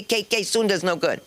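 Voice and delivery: ordinary talk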